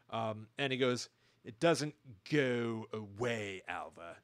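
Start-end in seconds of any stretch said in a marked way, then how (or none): 1.4-4.1 put-on slow, low, drawn-out pseudo-posh accent; unplaceable affected accent. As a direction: imitate a terrible fake transatlantic accent, softly and slowly. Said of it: "unplaceable accent".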